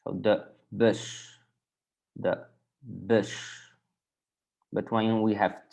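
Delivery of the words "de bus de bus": bus with a short rounded 'uh' vowel; slow, separated bus with a short Dutch u. Shown as a demonstration. Teacher models the tricky U, short rounded vowel in bus. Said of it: "we pronounce as e".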